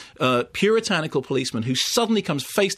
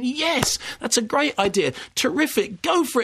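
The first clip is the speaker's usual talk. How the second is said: much higher, mock-eager agreement; mock enthusiasm; 9 semitones higher than usual. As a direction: gush with ironic enthusiasm. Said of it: "you think".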